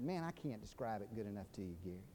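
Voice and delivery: low, soft, defeated admission; retold tearfulness. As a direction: give up softly, as if near tears. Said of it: "I began to cry".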